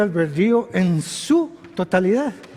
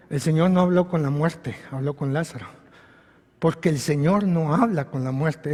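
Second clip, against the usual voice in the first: ordinary talk, nothing marked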